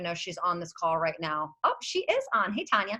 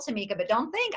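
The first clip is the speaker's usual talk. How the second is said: normal talking voice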